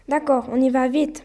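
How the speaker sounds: ordinary delivery, nothing marked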